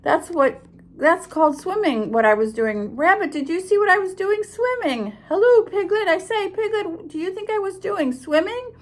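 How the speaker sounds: high squeaky excited chatter; young Roo squeaking excitedly to everyone. Acted. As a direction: bubble over like a proud little joey. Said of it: "squeaked Roo excitedly".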